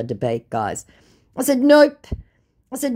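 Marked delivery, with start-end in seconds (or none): 1.6-2.1 high, loud, short no; emphatic refusal. Retold or imitated. Woman refusing the scammer's request, emphatic. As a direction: refuse outright, pitched up.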